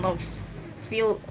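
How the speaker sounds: ordinary delivery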